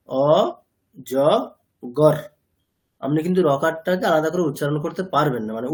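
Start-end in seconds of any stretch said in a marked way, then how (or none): 0.1-2.2 অ, জ, গর as three separate beats, o, jo, gor; syllable by syllable. Shown as a demonstration. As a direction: pause after each syllable, keeping গর closed as one. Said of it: "উচ্চারণের যোগ্য ক্ষুদ্রতম অংশ কি".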